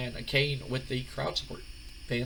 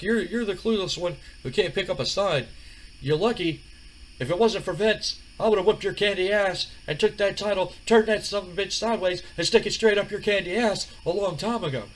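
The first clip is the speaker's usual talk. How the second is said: higher, louder trash-talking threat; The Rock, trash talk; 8 semitones higher, 3 dB louder than usual. Retold or imitated. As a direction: threaten him like a wrestling promo. The Rock insults Jericho.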